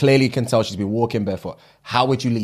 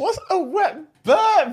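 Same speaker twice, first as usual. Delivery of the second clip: disgusted; slower than usual. Real, not acted. Disgusted high question.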